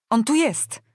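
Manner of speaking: imperious princess raising the alarm; shouted orders. A narrator's reading. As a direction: shout urgent commands to the guards. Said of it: "krzyczała królewska córka".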